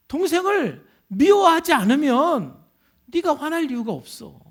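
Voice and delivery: high, forceful sermon delivery; impassioned preaching. Retold or imitated. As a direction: drive each phrase home from the pulpit.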